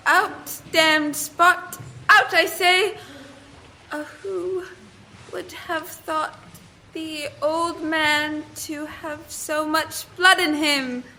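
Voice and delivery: dazed, slow, drifting lines, as if asleep; a haunted murmur that rises on out; sleepwalking Lady Macbeth. Acted. Farm girl thrust on stage plays the sleepwalking queen. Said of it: "Your sleepwalking scene".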